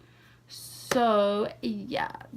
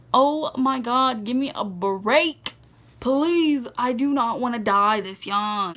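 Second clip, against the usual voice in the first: slow, emphatic, dramatic begging; loud, drawn-out exasperation; exasperated plea; 4 dB louder, slower than usual. Acted. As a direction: plead in mock despair, stretching the words. Exasperated plea after near crash.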